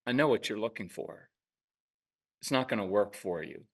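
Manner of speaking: calm, quiet matter-of-fact voice. Retold or imitated. Parent calmly tells glaring kid it won't work, quiet and even. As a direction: state it coolly, no heat. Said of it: "at times I will say".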